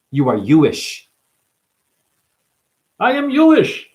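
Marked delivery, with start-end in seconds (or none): 3.0-3.9 very high, loud proclamation; mock proclamation.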